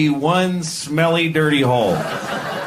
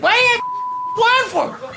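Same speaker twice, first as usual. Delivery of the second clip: very high squawking outburst; outraged wife; faster than usual. Acted. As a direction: shriek it as an indignant duck wife. Duck's wife yells at husband.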